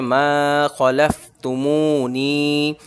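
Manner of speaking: very slow मा खलफ तुमू नी, मा and मू drawn long; slow chanted recitation; joined slow reading. Shown as a demonstration. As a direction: stretch मा, मू, नी, clip खलफ. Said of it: "अब मिलाकर पढ़ते हैं".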